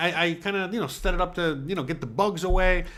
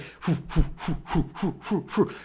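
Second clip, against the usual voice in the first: rhythmic soft whooshes; steady huffing sound effect; imitated running puffs; 5 dB quieter than usual. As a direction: puff along as if running.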